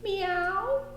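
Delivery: high, squeaky cat meow; mock meow. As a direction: meow like a little dog pretending to be a cat. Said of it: "said Widget".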